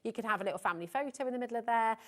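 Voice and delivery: quick auctioneer-style patter; fast talking. Real, not acted. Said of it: "I said it very quickly, like an auctioneer".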